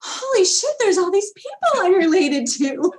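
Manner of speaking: high, loud stunned exclamation; high loud thrilled disbelief; amazed. Retold or imitated. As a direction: blurt it out in shocked delight. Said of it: "you're like".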